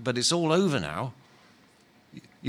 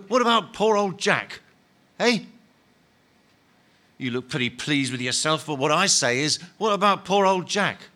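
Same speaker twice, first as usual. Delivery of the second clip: aggrieved complaint; 4 semitones higher than usual. Acted. Big ghost complaining to Len.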